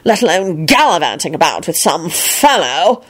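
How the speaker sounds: prim moral reproach; disapproving. A narrator's reading. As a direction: scold her like a pompous prig. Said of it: "Percy peered at her disapprovingly".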